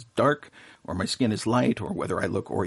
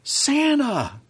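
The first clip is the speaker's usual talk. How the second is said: high, bright cry of recognition, much higher; a delighted gasp of a name, pitched way up; surprised recognition; 5 dB louder than usual. Retold or imitated. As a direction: call out Santa like you've just recognized him. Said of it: "looked at me for a second and said".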